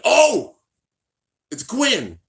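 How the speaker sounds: sharp high gasp of surprise; surprised. Real, not acted. Reacting to Gwyn's appearance, startled oh. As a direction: jump at what you see. Sudden sight of Gwyn.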